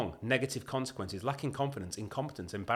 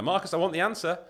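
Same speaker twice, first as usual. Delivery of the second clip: 1 mock demand; 7 semitones higher, 7 dB louder than usual. Imagined demand at gunpoint.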